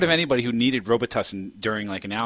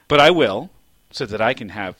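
usual speaking voice

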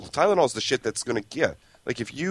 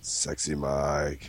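sexy voice; 6 semitones lower, 7 dB quieter, much slower than usual. Pretend. Mimics sexy voice slowly.

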